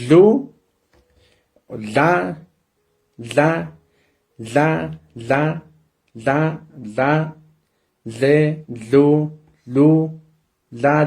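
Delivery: la le li lo lu, each syllable chanted separately; teacher leading a syllable drill. Shown as a demonstration. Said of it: "estos acentos son muy importantes".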